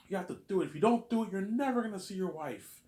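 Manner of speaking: squeaky imitation of a pushy stepmother; mock stepmother pleading. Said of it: "my stepmother was like".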